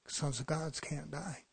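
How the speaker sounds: usual speaking voice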